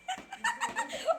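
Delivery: high, light giggling burst; amused laugh. Real, not acted. Aunt laughs at uncle being shown up, amused. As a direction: laugh at someone caught out.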